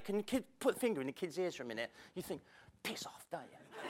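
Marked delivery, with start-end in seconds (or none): 2.8-3.8 muttered aside. Retold or imitated. Inner reply to the critic.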